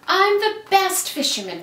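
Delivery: put-on high, loud boastful boy voice; proud cry. A narrator's reading. Max boasting to his brother and mama. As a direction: crow it out, fish held high. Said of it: "cried Max".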